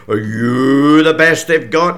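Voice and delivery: slow, loud sneering giant. Acted. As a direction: sneer it down at the little lad. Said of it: "he sneers".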